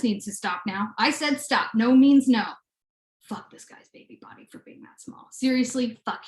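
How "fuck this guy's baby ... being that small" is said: whispered, playful outrage; mock angry whisper. Real, not acted. Cursing the pet's tiny body in a playful whisper. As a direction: whisper it like a secret curse.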